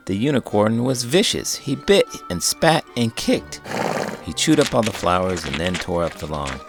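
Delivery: ordinary delivery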